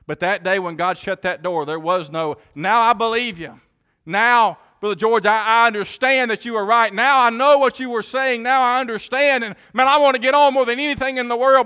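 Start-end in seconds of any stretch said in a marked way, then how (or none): 2.5-11.7 high, loud pleading cry of the lost; desperate begging. Acted. Sinners outside the ark beg Noah too late, loud and pleading. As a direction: beg to be let on the boat, voice straining high.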